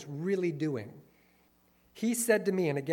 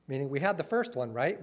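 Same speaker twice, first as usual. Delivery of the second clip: normal talking voice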